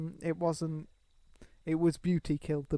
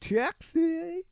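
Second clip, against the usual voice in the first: high-pitched New York call for a cab; New York accent; 12 semitones higher than usual. Shown as a demonstration. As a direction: hail a taxi in a put-on New York accent. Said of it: "shout taxi, New York accent".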